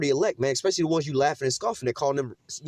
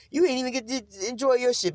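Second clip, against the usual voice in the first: laughing; 9 semitones higher than usual. Real, not acted. Laughs mocking the prepper.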